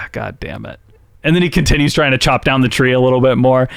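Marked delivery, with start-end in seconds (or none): 0.1-0.8 much softer, lower, resigned; defeated quiet curse; resigned mutter. Retold or imitated. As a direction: let it fall out under your breath. Elliot resigned after the sigh.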